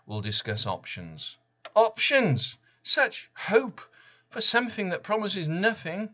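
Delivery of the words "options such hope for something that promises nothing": much higher and louder, scornful cry; bitter outburst. Real, not acted. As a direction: spit the word back in disbelief. Bitter reaction to consultant's words.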